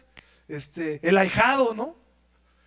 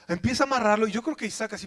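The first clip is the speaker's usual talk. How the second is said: ordinary talk, nothing marked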